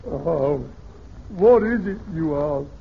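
normal talking voice

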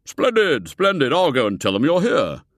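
loud, beaming splendid; delighted old gentleman. A narrator's reading. Foulard pleased, loud and beaming. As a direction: relish the news.

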